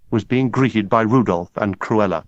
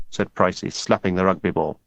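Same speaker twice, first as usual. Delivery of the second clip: ordinary delivery, nothing marked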